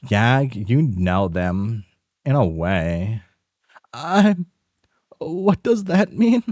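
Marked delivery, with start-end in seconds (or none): 3.9-6.4 high, startled questioning voice; high confused caller. Acted. A confused friend on the phone asking who is calling, high and puzzled. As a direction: sound baffled on the phone.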